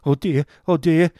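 loud, high wail of distress; high-pitched tearful outburst; bursting into tears. A narrator's reading. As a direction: cry it out in despair. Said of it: "now he burst into tears".

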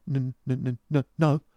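put-on high, nervous stuttering boy; frightened, halting, high-pitched reply; timid stammer. A narrator's reading. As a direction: stammer it out, afraid to offend. Timid boy stammers, afraid to offend.

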